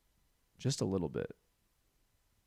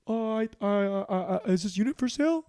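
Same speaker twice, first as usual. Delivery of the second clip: high, fast, flustered stammer; stammering caught intruder; 8 semitones higher, 4 dB louder than usual. Retold or imitated. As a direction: scramble for an excuse, pitched up.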